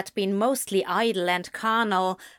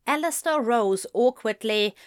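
plain reading voice

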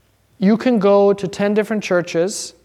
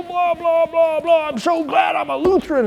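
high mocking voice of a careless believer; mock shallow Lutheran; 11 semitones higher than usual. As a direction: send up a smug churchgoer, high-pitched.

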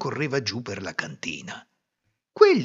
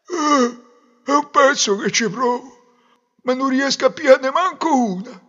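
high, loud simpleton voice; foolish nut gatherer; 13 semitones higher, 7 dB louder than usual. Acted. Fool explaining his failure to the groom, put-on simpleton voice. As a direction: complain like a struggling dimwit.

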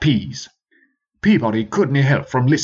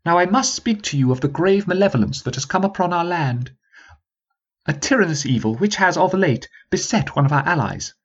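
posh prince voice; 7 semitones higher than usual. Acted. Peabody's speech, posh voice.

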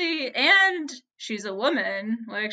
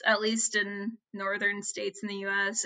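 ordinary talk

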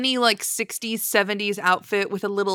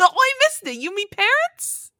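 high, squeaky mock-British child; bad British accent; 13 semitones higher than usual. Host twisting the joke for Ed and Lorraine, bad British accent. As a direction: stretch it out in high fake British. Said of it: "do a bad British accent".

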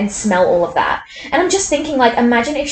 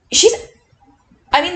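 ordinary talk, nothing marked

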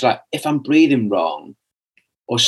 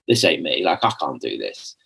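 muttering; 8 semitones lower, 5 dB quieter than usual. Retold or imitated. Giving up on meditation.

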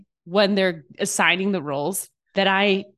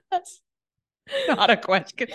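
laughing; 11 semitones higher than usual. Real, not acted. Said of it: "Confirmed, not a question".

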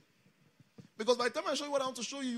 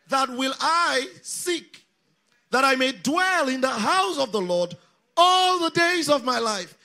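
congregational scripture reading; 4 semitones higher, 11 dB louder than usual. Real, not acted. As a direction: lead the crowd in reading scripture aloud. Leads congregation reading the psalm aloud.

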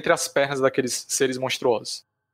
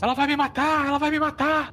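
high, frantic cry for help; panicked scream; 13 semitones higher than usual. Acted. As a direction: shriek it in terror to the others. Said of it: "ela começa a gritar pros outros".

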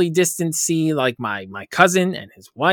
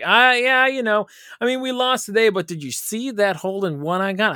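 mock golfer bragging; 7 semitones higher than usual. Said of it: "Be like".

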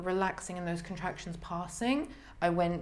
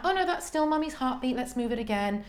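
high lilting reassurance; higher sing-song doctor; sing-songy; 6 semitones higher than usual. Retold or imitated. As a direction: keep it light and musical. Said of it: "she was very sing-songy".

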